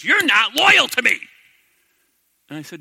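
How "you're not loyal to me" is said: hurt wife. Retold or imitated. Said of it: "She goes".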